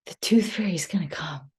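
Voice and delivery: mock child's thrilled whisper; excited child's gasp. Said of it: "she goes like this".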